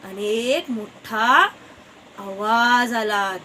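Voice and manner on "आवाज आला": ordinary delivery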